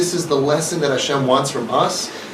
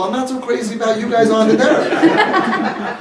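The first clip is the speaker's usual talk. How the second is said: teasing laugh; 5 semitones higher than usual. Real, not acted. Teasing joke to audience.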